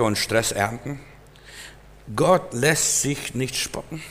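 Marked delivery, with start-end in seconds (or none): none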